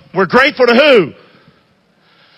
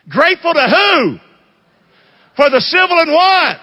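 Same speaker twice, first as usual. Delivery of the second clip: full-force preaching; 6 semitones higher than usual. Acted. Preacher calling the congregation to answer, preaching at full force. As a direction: rouse the congregation and make them shout the answer back.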